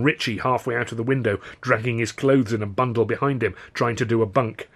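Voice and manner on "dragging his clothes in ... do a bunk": ordinary delivery, nothing marked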